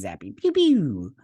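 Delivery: pew pew like a kid playing with a toy gun; blaster sound effects. Recapping the space battle, mouthing the blasters playfully. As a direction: spit out little laser noises.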